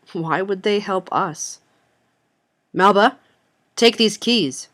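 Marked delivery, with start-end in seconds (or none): 2.7-4.7 loud, urgent order; commanding. A narrator's reading. Mother tossing car keys to her daughter, commanding. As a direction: raise your voice and give an urgent command. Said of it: "Mother commanded as she tossed them".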